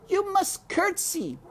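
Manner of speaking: high, loud insistent shout; head servant shouting. A narrator's reading. Louise shouting the rule at Mig, high and loud. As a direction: shout it so she gets it. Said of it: "shouted Louise".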